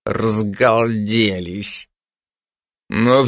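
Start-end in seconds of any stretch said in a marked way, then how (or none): 0.0-1.9 low, slow disgust; through gritted teeth. Acted. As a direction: force it slowly through clenched teeth. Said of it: "процедил он сквозь зубы". (сквозь зубы, презрительно)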